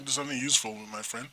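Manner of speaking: ordinary talk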